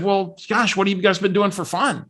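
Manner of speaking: friendly curious woman, gently imitated; imitating Barbara. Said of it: "I gotta imitate Barbara".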